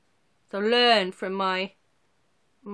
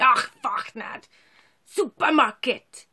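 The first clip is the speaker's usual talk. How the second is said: Och, fuck, nad growled louder like a curse, then Supermarket slow and louder; grumpy mock curse; 4 semitones higher, 6 dB louder, slower than usual. Shown as a demonstration. Showing viewers a Welsh word said as a grumpy mock curse. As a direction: spit out "Och, fuck, nad" like swearing in a bad mood. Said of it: "say when you're in a bad mood".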